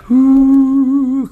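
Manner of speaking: high, loud ghostly ooh; eerie drawn-out ooh; spooky ooh. A narrator's reading. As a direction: moan it like a ghost. Spooky graveyard.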